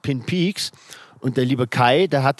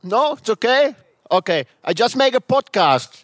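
raised, higher voice for a foreigner; speaking louder; 8 semitones higher, 3 dB louder than usual. Real, not acted. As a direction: speak up slowly in English to the neighbor. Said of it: "So sometimes I have to speak a little bit louder".

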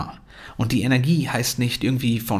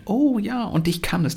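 put-on high-pitched child's sudden delight; begeistertes Kind; 9 semitones higher than usual. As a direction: play a kid realising it works. Child answers encouraged parent. (hohe, freudige Kinderstimme)

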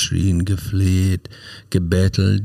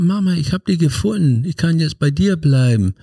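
put-on much higher, childlike plea in a strange voice; pleading little boy; 9 semitones higher than usual. Small John begging his mother to let him stay, high childlike plea.

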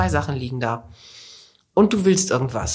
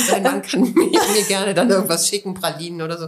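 laughing; 2 semitones higher than usual. Real, not acted. Joking request for chocolates.

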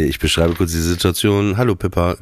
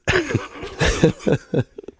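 high breathless laugh; 3 dB quieter than usual. Real, not acted. Laughs at the chauffeur idea.

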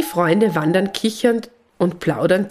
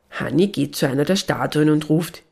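usual speaking voice

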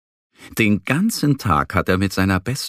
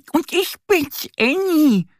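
little duck voice. A narrator's reading. Duck Annie holding her full belly, high squeaky voice. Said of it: "meint die Ente".

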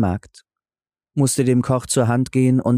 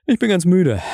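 lightly voiced tired husband, higher; a touch of weary complaint, pitched up and falling; weary beggar; 7 semitones higher, 4 dB louder than usual. A narrator's reading. Beggar character complains of weariness.

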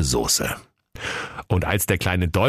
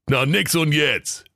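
curt reply; 8 semitones higher, slower than usual. Acted. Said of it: "rief der Jakob Grimm".

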